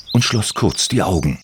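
usual speaking voice

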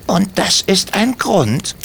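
high, slow and pointed; stern kobold question. Acted. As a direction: ask it slowly so they feel ashamed. Timmy repeats rebuke.

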